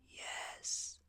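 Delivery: barely audible dying whisper; very soft, voiceless reply; faint whisper. A narrator's reading. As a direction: breathe the answer out weakly. Dying man answers interrogation.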